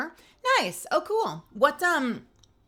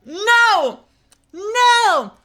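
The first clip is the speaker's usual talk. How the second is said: loud high cry of no; shouting no; 10 dB louder than usual. Real, not acted. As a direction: yell it out in disbelief. Recognises the horrifying game.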